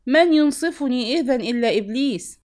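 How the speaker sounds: loud protest. A narrator's reading. Drunk husband answering the scolding sheikh, loud protest. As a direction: cry out against the injustice.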